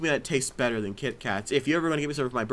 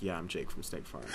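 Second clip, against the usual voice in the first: much lower, very soft deadpan; Jake from State Farm; 8 semitones lower, 12 dB quieter than usual. Acted. Costumed guest answering people who recognize him, low character voice. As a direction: drop it low and understated. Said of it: "that's how I talked the whole time".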